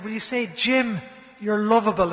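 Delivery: rally the room; rousing call. Retold or imitated. Jim rallying a schoolful of girls, loud call.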